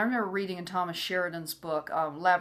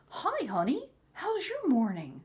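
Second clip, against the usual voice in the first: high, sing-song mock sweetness; sugary cheerful greeting; 8 semitones higher, slower than usual. Husband greets wife after his outburst, mock cheery. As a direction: imitate an oblivious husband, much higher pitched. Husband acting as if nothing happened.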